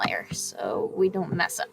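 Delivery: whispering. Real, not acted. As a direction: breathe it out quietly. Whispered aside.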